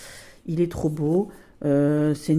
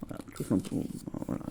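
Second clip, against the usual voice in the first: lower, whispered wrap-up; whispered aside; 8 semitones lower, 8 dB quieter, faster than usual. Real, not acted. To viewers after the quote, a whispered aside. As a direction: toss it off under the breath.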